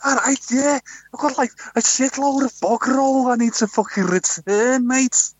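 high, slow, hammed-up Scouse-Aussie mix; a customer in a muddled Liverpool accent; mock Liverpudlian accent. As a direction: Liverpudlian accent gone wrong, pitched far up. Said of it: "I didn't know you were Liverpudlian".

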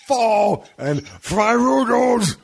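hammed-up Welsh accent, high and drawn out; joking Welsh accent. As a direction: stretch the words in a mock Welsh lilt. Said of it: "I'm just doing my Welsh accent".

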